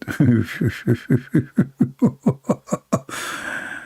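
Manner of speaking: long quiet wheezing laugh; long soft laugh. Real, not acted. Amused that YouTubers have a field day, long soft laugh. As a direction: let a long soft chuckle run.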